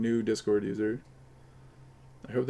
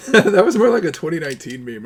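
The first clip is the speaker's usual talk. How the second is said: laughing tease; 4 semitones higher, 10 dB louder than usual. Real, not acted. Laughing while teasing.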